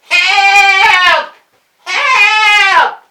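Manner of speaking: high, full-volume holler; hollering for help. Acted. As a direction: holler long and loud across the fields. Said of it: "We hollered and hollered".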